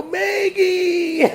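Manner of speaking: high, drawn-out call of "Maggie"; called name. Retold or imitated. Tony calls back to Maggie, high drawn call.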